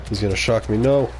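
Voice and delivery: ordinary talk, nothing marked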